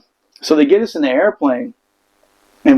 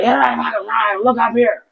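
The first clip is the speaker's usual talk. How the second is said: higher, barked command; drill instructor yell; 7 semitones higher than usual. Retold or imitated. Former drill instructor ordering the Marines to look up, yelling. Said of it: "Comes out".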